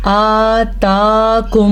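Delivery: आताकुम with both vowels drawn long; slow drawn-out आताकुम. Shown as a demonstration. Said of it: "तो हम इस मद को पढ़ेंगे".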